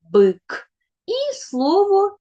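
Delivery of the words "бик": «бик» said louder, clearly; sound it out. Shown as a demonstration. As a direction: say «бик» with the kids. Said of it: "вимовіть зі мною". (вимовте «бик» чітко)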